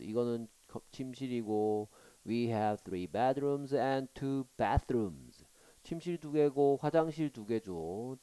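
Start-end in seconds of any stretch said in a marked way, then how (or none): 2.3-5.4 very slow reading. Shown as a demonstration. Teacher reads slowly.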